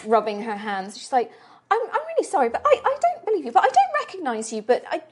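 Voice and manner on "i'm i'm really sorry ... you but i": high, hurried, faltering woman; worried. Acted. Halfling prisoner who doesn't recognise the guard, high and worried. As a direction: stumble anxiously over the words in a high voice.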